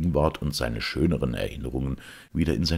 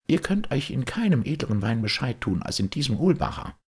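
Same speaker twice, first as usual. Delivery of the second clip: a lightly raised, cheerful farmer offering wine; hearty peasant host; 11 semitones higher than usual. Acted. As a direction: urge the lords to drink. Hans praises his wine.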